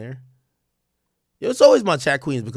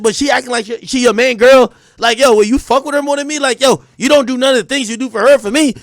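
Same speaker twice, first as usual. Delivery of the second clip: put-on nagging girlfriend, higher and louder; chirping girlfriend; 7 semitones higher, 5 dB louder than usual. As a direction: complain like a jealous girlfriend. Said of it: "when a girl start chirping".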